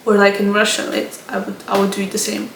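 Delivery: ordinary delivery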